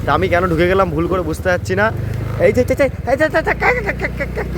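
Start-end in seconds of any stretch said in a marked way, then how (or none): 2.4-4.6 high panicked cries of slipping; startled yelp. Real, not acted. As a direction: yelp as you lose balance. Slipping on wet road.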